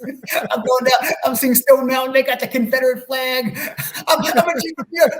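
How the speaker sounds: half-laughing, high and loud, words breaking into laughter; laughing while telling. Real, not acted. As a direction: laugh through it as the memory comes back.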